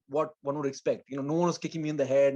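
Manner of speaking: ordinary talk, nothing marked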